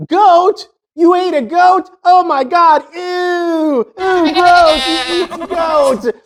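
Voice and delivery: put-on high, grossed-out kids' squeal; imitating horrified kids, high-pitched and drawn-out; mock disgust. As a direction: squeal it in exaggerated revulsion. Kids disgusted by curry goat story.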